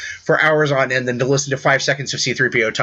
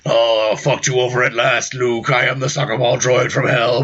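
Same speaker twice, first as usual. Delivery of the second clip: mock villain gloat; 2 semitones lower than usual. Voicing villain droid reveal.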